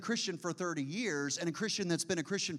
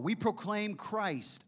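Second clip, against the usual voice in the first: slow, raised reading of We proclaim Christ; scripture reading; 5 semitones higher, slower than usual. Acted. Preacher reads Paul slowly to the congregation. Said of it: "this is Paul".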